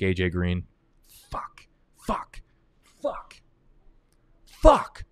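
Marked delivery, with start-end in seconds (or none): 1.3-3.4 quiet swearing. Real, not acted. Missed his draft pick. 4.5-5.0 a sudden high yelled curse; frustrated shout. Real, not acted. Drafter yelling at himself after missing his pick, frustrated shout.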